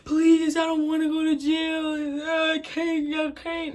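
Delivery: mock crying plea. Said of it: "I'm sitting there crying".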